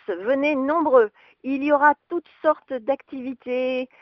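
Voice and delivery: loud town crier proclaiming; royal herald. Acted. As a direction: call it out like a herald in the square. Announcement opened with town crier's call.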